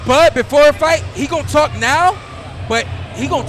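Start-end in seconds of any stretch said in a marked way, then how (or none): 0.1-2.2 loud, high hype call; hyped shout. Real, not acted. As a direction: shout it out like a fight promo. Hyping a fighter's trash talk.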